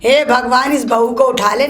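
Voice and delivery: ordinary talk, nothing marked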